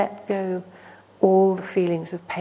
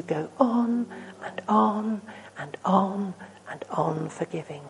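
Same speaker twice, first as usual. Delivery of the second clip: slow, fading repetition; 7 dB quieter than usual. Acted. Drawing out endless forgiveness.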